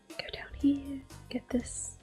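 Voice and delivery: ordinary talk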